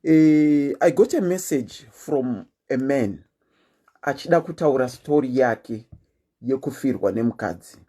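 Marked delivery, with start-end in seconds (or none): none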